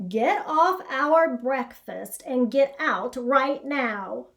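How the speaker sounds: a bossy cow scolding a rabbit. Acted. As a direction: order him off the hay. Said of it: "a bossy old cow".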